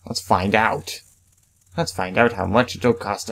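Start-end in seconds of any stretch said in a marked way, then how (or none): none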